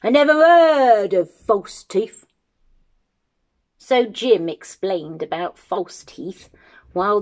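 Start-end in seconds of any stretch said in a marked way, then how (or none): 0.0-2.3 loud, high indignant bellow; giant's roar. Acted. Giant answers Jim about false teeth, roaring. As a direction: roar it out in astonished disbelief. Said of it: "roared the giant".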